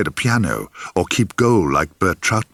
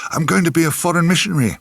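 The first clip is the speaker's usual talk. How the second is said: put-on blurted fast and higher, like a startled little boy; gasped child's answer; 7 semitones higher, faster than usual. A narrator's reading. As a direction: gasp it out quickly in pain, a child on the spot. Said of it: "the poor little sod would gasp".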